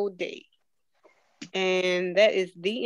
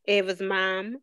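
plain reading voice

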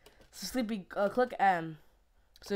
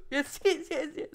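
laughing excited cheering; excited yes; 6 semitones higher than usual. Real, not acted. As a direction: cheer the win gleefully. Excited win in game.

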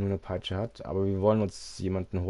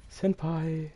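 excited anime fan; 8 semitones higher than usual. Pretend. Anime word in high voice.

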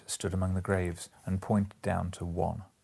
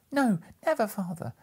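high, loud cry; children protest together, much higher; crying out again; 13 semitones higher, 3 dB louder than usual. A narrator's reading. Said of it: "they all cried again".

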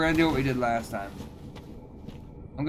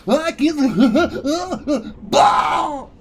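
high, slow, laughing yell; laughing clown shout; 13 semitones higher, 8 dB louder, much slower than usual. Retold or imitated. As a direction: shout it while cracking up. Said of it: "He's like".

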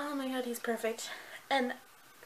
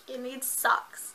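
slow, plain "You need socks"; slow deadpan line; much slower than usual. Retold or imitated. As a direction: say it slowly and flatly. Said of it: "he's like".

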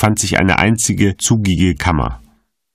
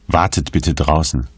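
ordinary delivery, nothing marked

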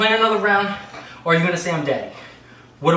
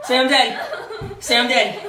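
usual speaking voice

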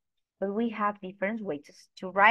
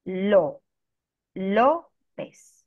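"López" broken up with a loud, held LO; teacher demonstrating the stressed O in "López"; stress on first syllable; 5 dB louder, much slower than usual. Shown as a demonstration. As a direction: punch LO in "López", then pause before the ending. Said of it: "In that vowel, we do an intonation".

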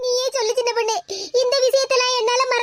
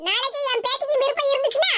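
higher, scolding elder; old mother-in-law; 4 semitones higher than usual. Acted. The grandmother scolds her daughter-in-law for making the girl cry.

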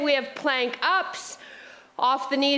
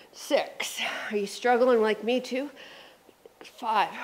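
whisper; 2 semitones lower, 4 dB quieter than usual. Real, not acted. Whispered during hard reps.

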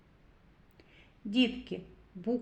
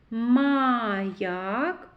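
Маяк with a long МАА before ЯК; drawn-out first А. Shown as a demonstration. Teacher picks out the vowel, first А drawn out. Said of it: "а голосний".

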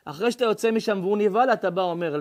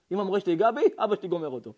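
normal talking voice

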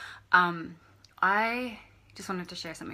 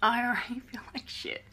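tearful, breaking laugh; a sob turning into a laugh; crying; 5 semitones higher, 5 dB louder than usual. Real, not acted. Repeating to viewers she feels bad, tearful. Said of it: "Now I'm crying".

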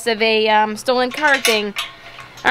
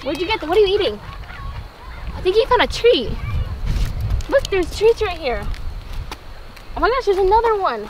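talking to a dog; 6 semitones higher than usual. Real, not acted. Baby talk to dog.

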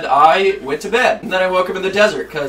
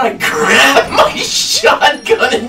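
laughing; 13 semitones higher, 6 dB louder, much slower than usual. Real, not acted. Laughing fit.

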